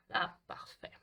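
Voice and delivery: breathy whispered voilà parfait. Real, not acted. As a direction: murmur it to yourself. Whispered aside while crafting. (chuchoté pour soi)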